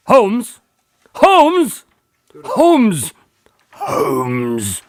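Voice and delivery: worried shout for a missing friend; calling out. Acted. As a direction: call for him with growing alarm. Retake calling for Holmes.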